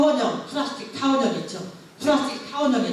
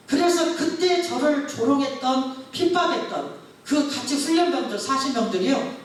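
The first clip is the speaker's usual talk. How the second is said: preaching build; 5 semitones higher than usual. Real, not acted. Preaching climax about mockers.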